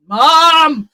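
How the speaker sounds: loud, high yell across a supermarket; a child's drawn-out holler; shouting for mum. Retold or imitated. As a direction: bellow for your mum. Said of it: "just shouting".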